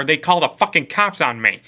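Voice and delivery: ordinary talk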